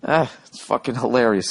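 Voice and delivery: usual speaking voice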